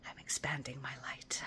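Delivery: hushed self-affirmation; whisper. Acted. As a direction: breathe it out as a private affirmation. Affirmation said to herself.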